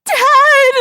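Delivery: long, high, loud wail; scream. A narrator's reading. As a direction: scream for your father. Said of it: "Sue screamed".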